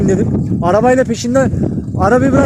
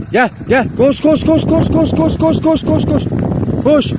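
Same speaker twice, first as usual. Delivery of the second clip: high, quick urging to run; eager high calling; 7 semitones higher than usual. Real, not acted. Urging the stray dogs to run to him, high and eager. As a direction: cheer the dogs on to come running.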